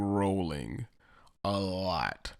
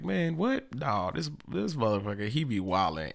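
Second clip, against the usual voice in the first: comic incredulous denial, pitched up; acted lying friend; 3 semitones higher than usual. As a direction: play a liar feigning shock, voice raised. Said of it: "It's like".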